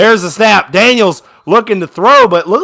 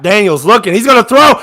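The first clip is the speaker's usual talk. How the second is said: ordinary delivery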